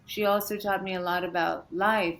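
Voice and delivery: normal talking voice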